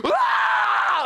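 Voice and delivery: loud, very high imitated baby scream; long piercing mock wail; newborn's scream. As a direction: scream like a newborn in full cry. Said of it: "she's, like, screaming".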